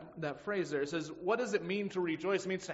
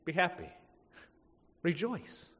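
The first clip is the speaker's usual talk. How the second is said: much higher and louder, ironically bright; mocking the cheerful response to trouble; mock chipper; 8 semitones higher, 7 dB louder than usual. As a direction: toss it off like it's good news. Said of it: "counterproductive to say".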